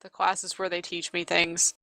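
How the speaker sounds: quiet, sullen deadpan; sullen mumble. Acted. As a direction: brush her off, barely bothering.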